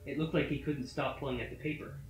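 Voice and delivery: ordinary delivery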